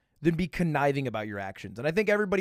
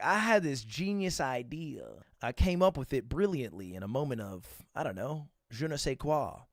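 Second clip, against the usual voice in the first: pompous self-praise; 4 dB quieter than usual. Retold or imitated. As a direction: play the self-satisfied creator. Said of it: "and be like".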